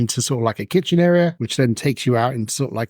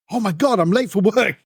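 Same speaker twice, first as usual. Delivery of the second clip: flustered; 8 semitones higher than usual. Acted. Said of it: "And he's like".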